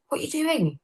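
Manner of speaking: mock a startled stage manager asking; high, soft imitation of a worried stage manager; alarmed stage manager. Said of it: "the stage manager's going".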